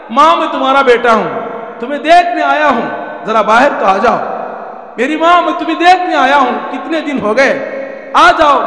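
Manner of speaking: loud, high pleading call through a door; pleading cry. Acted. Son calling out to his mother through the locked door, loud pleading.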